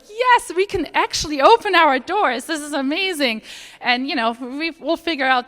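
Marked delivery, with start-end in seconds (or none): 0.1-3.4 higher and louder, retelling their own cheer; a light replay of the joy of getting the loan; mock-triumphant retold cheer; celebrating.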